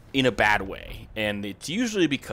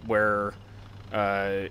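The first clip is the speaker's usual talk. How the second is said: ordinary talk, nothing marked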